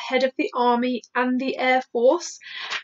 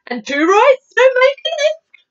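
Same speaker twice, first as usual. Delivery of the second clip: high, loud interjection; giant's cry; 13 semitones higher, 6 dB louder than usual. Acted. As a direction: pipe up loudly, pitched way up. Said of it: "cried the B F G".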